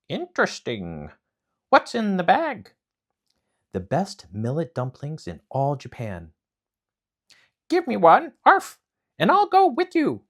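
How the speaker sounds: high, loud yappy dog voice; a puppy barking out the question; eager dog. Acted. Dog greets Momotaro on the mountain, high yappy bark.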